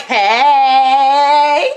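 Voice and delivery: singsong, stretched-out, loud greeting; Stanley. Acted. Imitating a man greeting her across the gym, singsong hey. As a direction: call hey long and gleeful across a gym. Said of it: "he's literally like".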